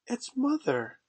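put-on high-pitched girlish exclamation; excited cry. A narrator's reading. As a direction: cry out at a joyful discovery, high. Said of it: "cried Wendy".

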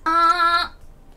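high level あー held high and flat; first tone. Shown as a demonstration. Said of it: "発音って四種類しかない". (高く平らに伸ばす「あー」)

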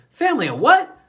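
high, loud astonished question; can't believe what he's hearing; surprised. A narrator's reading. Clancy at headquarters asking Michael in disbelief, high and loud.

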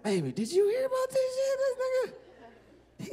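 high falsetto gossip, drawn out; mock girlfriend voice. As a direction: squeal it like a stunned girlfriend. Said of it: "be like".

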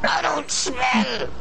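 high, drawn-out whiny protest; mock wail. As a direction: wail it out indignantly in play. Mock protest at being teased.

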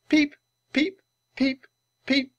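very high little chirps; baby penguin peeps. Acted. Revived Penny greets each friend, high peeping. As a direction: squeak a greeting like a chick. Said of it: "she greeted them".

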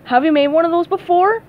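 lightly voiced nervous producer; worried boss. Sam asks SpongeBob about soufflés, mildly anxious.

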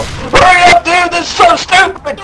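high, loud panicked shout; screaming. Retold or imitated. Keith screaming at Ellis to hurry, loud panicked shout. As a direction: scream it while holding a live snake. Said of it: "Keith screamed".

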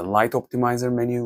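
ordinary talk

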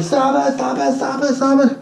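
high yelled commands to break up a fight; retold shout. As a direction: bark at the girls to let go.

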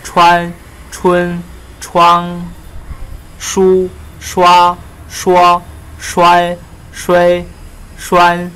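loud, high, one syllable at a time; pinyin drill. Shown as a demonstration. Teacher drilling Vietnamese students on pinyin, loud and high. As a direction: call out each sound clearly for the class to copy.

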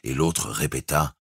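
plain reading voice

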